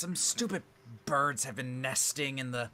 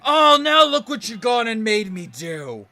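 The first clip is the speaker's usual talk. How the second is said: much higher and louder, blaming; loud huffy complaint; 9 semitones higher, 13 dB louder than usual. Acted. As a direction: accuse them in a loud huff. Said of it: "and he says".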